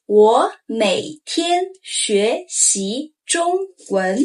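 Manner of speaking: 我每天学习中文 very slowly; drawn-out, every tone clear; slow model sentence. Shown as a demonstration. Teacher asking learners to say it aloud, slow. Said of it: "Say aloud".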